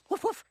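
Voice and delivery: mock barking. Flirting, mocking barking orders.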